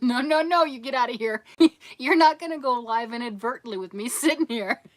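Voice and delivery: loud, high laughing protest; laughing husband shooing. Retold or imitated. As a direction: shoo her away through laughter. Husband shooing her away.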